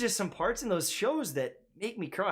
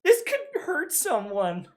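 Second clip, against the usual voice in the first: mock tearful quote; 8 semitones higher, slower than usual. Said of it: "'cause I was like".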